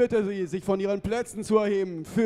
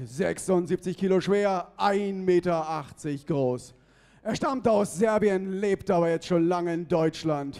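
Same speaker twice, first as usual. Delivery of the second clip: ordinary delivery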